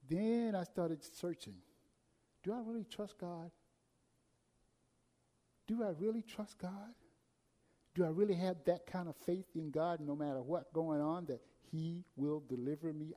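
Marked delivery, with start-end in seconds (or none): none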